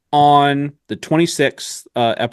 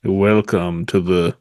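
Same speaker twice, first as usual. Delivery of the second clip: low, slow seductive voice; sultry voice; 6 semitones lower, slower than usual. Pretend. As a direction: drop deep and slow, seduce the listener. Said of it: "use a deeper tone".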